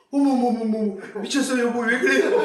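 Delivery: high, loud flustered squeal of a shy wife; flustered surprise. Retold or imitated. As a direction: gasp it out, embarrassed and delighted. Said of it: "당황하신 거예요". (당황해서 높고 크게 소리치며)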